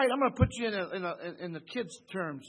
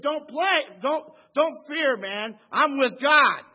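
higher, much louder, street-talk Joseph; loud casual Joseph; 7 semitones higher, 12 dB louder, slower than usual. Acted. Joseph reassuring his brothers, loud and high in everyday slang. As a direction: let the whole church hear Joseph wave off their fear.

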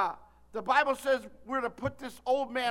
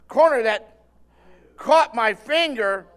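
dramatic retelling; 16 dB louder, slower than usual. Retold or imitated. Retelling the painful moment.

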